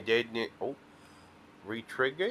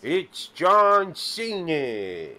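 announcer voice; 5 semitones higher, 4 dB louder, much slower than usual. Pretend. Announcing wrestler name slowly.

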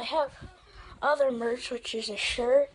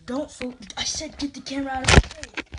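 angry repeat; 6 dB louder, faster than usual. Real, not acted. Brother repeating angry demand.

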